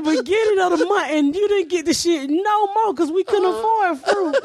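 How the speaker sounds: high, laughing near tears; laughing half-cry. Real, not acted. Comic telling friends, laughing to tears. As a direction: crack up until it sounds like crying.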